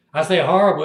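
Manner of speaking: ordinary talk, nothing marked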